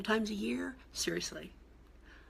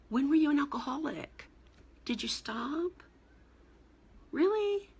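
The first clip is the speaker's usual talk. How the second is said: mock nosy questioning; 5 semitones higher than usual. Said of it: "who would say things like".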